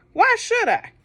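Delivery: high, loud mocking Pharaoh; defiant Pharaoh. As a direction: sneer it back at Moses. Pharaoh defies Moses.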